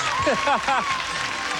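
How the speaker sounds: host's amused burst of laughter; real laugh. Real, not acted. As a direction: laugh it off in front of the crowd. Host reacts to cheering audience.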